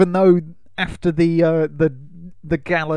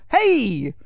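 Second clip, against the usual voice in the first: put-on higher, cheeky New York kid's call; 7 semitones higher than usual. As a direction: holler like a New York street kid. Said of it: "Typical New York kid".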